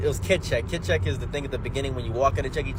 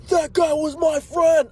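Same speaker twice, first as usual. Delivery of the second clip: high, loud, slow wailing protest; hammed-up grieving shout; second dad yelling after his friend falls, slow and loud; mock outraged dad; 13 semitones higher, 6 dB louder, slower than usual.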